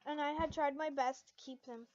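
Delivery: usual speaking voice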